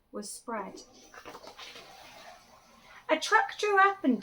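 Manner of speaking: put-on lively read-aloud for children; animated picture-book reading; storytelling voice. A narrator's reading. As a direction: tell it like a bedtime picture book.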